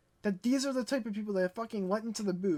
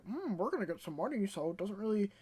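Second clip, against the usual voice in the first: softer, shrugging imitation of a company; couldn't-care-less mimicry; mock indifference; 6 dB quieter than usual. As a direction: play them smugly not caring. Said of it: "They're just like".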